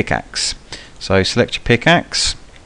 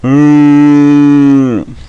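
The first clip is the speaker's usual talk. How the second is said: zombie voice; 8 semitones higher, 6 dB louder, much slower than usual. Pretend. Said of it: "That's my impression of a zombie".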